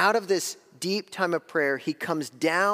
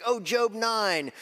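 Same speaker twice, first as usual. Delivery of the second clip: higher, drawn-out mock realization; imagined Peter's realization; 6 semitones higher, slower than usual. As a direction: play a sudden dawning, drawn out. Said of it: "I don't think he was like".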